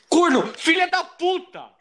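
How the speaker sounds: screaming at the game in rage; furious shout. Real, not acted. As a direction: yell it out, high and loud. Raging at the game.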